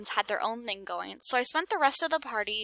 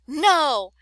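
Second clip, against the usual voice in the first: a high, loud no; loud refusal; 5 semitones higher, 7 dB louder than usual. Acted. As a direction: slam the door on the favor. Jin's refusal grows.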